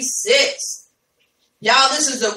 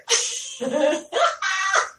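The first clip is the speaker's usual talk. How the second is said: high laugh; 7 semitones higher, 5 dB quieter than usual. Real, not acted. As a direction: burst of real high-pitched laughter. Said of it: "I'm just laughing at Adrian".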